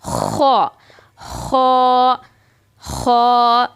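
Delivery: खो with a rough throat kh; raspy kho. Shown as a demonstration. Teacher demonstrating kho with throaty friction. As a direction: scrape खो in the throat. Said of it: "इसमें ख की आवाज़ आनी चाहिए".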